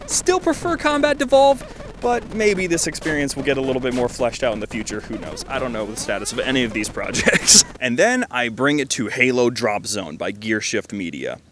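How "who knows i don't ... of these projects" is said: ordinary talk, nothing marked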